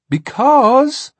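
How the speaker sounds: put-on high, loud, eye-rolling boy; exasperated. A narrator's reading. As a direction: drag it out in exasperation. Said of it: "in exasperation".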